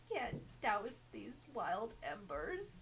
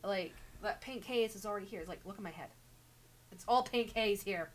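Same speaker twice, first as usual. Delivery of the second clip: normal talking voice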